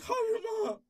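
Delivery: very high, loud frantic squeal; frantic girlfriend. Retold or imitated. Girlfriend panics over his bleeding, high loud squeal. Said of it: "She's like".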